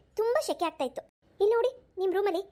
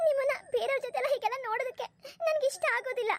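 high-pitched, hurt complaint; on the verge of crying while reproaching; tearful; 6 semitones higher than usual. Acted. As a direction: let the hurt rise into the voice. Wife tearfully confronts her husband.

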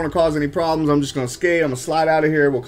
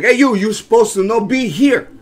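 loud, higher mock Spanish accent; weird Spanish accent; 5 semitones higher, 6 dB louder than usual. Retelling the man who yelled at him, loud mock Spanish accent. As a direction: imitate the man's Spanish accent as he yells. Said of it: "excuse my weird little accent".

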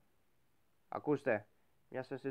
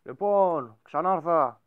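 high, loud announcement; loud return; 5 semitones higher, 11 dB louder, much slower than usual. Real, not acted. Gamer announcing his return, loud. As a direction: announce the comeback.

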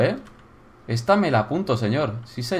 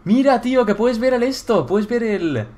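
amazed excitement; 7 semitones higher than usual. Real, not acted. Player amazed at the view, high excited wonder. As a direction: marvel aloud in a raised voice.

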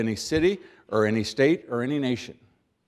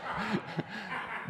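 laugh; 10 semitones higher, 12 dB quieter than usual. Real, not acted. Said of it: "I am now going to pause for emphasis".

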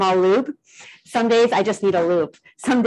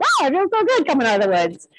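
higher, light mock relief; relieved delight; 8 semitones higher than usual. Artist quoting herself after a forest walk, lightly happy. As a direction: replay the happy sigh of leaving the woods.

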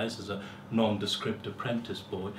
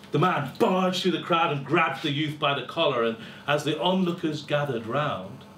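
usual speaking voice

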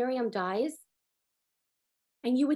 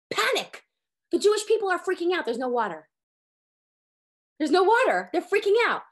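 raised, louder frantic retelling; mock panic; 4 semitones higher, 4 dB louder than usual. Teacher acting out the thirsty crowd in a panic. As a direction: act out the people panicking.

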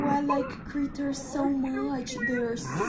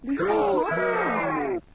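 calling out; 5 semitones higher, 4 dB louder, slower than usual. Real, not acted. Asks viewers to comment.

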